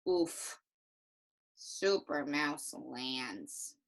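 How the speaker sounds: low, soft, slow and dejected; slow, sorry little reading; sad, hurt voice. Shown as a demonstration. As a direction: deflate as if it hurt. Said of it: "like, a sad voice or a hurt voice".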